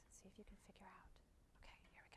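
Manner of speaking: hushed whisper so as not to talk over; breathy aside under the audio. Real, not acted. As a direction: whisper it to the room. Host hushes while clip plays.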